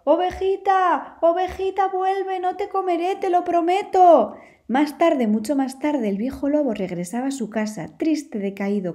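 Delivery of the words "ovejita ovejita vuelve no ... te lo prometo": calling out. Acted. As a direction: call out desperately into the forest.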